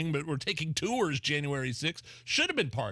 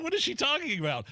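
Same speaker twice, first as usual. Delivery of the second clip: incredulous; 12 semitones higher than usual. Real, not acted. Mocking disbelief at guest.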